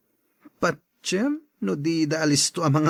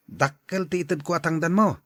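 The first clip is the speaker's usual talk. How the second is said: ordinary talk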